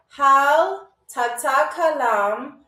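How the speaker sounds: each Arabic syllable stretched right out; very slow. Shown as a demonstration. Teacher saying it again for stuck players, very slowly. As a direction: repeat it at a crawl so they can think. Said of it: "I'm gonna say this very slow".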